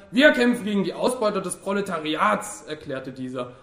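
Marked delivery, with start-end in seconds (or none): 0.1-2.6 raised, loud party slogan; combative declaration. A narrator's reading. Worker defends his party to Fabian, combatively loud. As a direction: declare it like an agitator.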